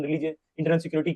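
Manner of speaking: ordinary talk, nothing marked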